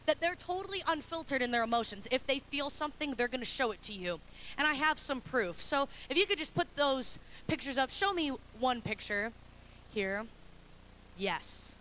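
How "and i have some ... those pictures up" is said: ordinary delivery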